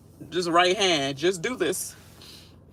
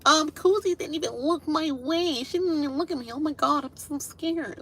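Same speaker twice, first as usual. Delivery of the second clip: high, soft whiny mock fear; victim noise; 10 semitones higher, 3 dB quieter than usual. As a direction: whimper it like a fake victim. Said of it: "freaking victim noise".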